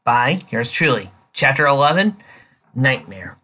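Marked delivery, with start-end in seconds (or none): none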